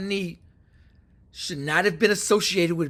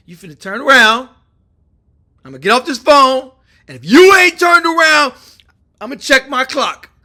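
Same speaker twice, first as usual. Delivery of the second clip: raised, forceful ultimatum, easing at the end; loud mock threat; 5 semitones higher, 10 dB louder than usual. Trump warns Russia to turn around, loud and menacing. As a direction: threaten loudly, stretching the big warnings.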